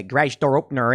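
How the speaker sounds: hammed-up old-school Chicago accent. Shown as a demonstration. Showing how nobody talks anymore, broad Chicago accent. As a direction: lay the Chicago accent on thick. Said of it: "nobody really talks like that".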